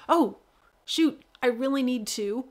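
mock "oh shoot" moment, emphatic; acted little jolt of remembering; sudden realization. Coach acting out suddenly recalling a to-do, mock alarm.

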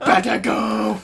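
slow rasping battle cry; Bat Dad. Acted. Friend charges at ball, Bat Dad voice. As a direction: stretch it out in hero rasp. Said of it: "I was doing Bat Dad".

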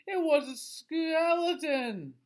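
high, loud, slow dramatic reveal; shocked reveal. A narrator's reading. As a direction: land the shock of the skeleton.

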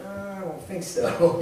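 slow, drawn-out doubtful reply; reluctant Gideon. Acted. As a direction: balk at it, dragging the words. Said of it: "Gideon's gonna be like".

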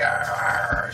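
breathy imitation of an angry little dragon growl; mock dragon growl. Owner imitating her angry dragon, a breathy mock growl. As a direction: voice the lizard's grumpy growl. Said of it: "She's like".

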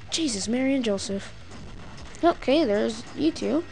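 ordinary talk, nothing marked

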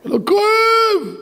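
very high shouted protest; high, drawn-out yell; acted screaming. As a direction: cry out, stretching the last words. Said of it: "צורח צרחות נוראות".

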